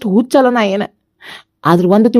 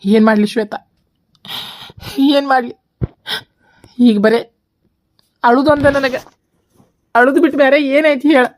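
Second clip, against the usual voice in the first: tearful; 4 semitones higher than usual. Acted. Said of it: "ಅಳಾಕತ್ತಿ ಯಾಕ್ ನೀನು?".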